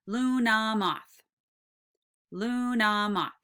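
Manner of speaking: Luna moth in three held, even beats; syllable by syllable. Shown as a demonstration. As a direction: tap out Luna moth, stretching each syllable. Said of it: "tap each syllable".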